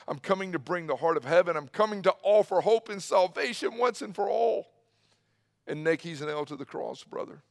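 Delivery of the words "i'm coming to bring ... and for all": building fervor. Acted. Preacher voices Jesus, building in fervor.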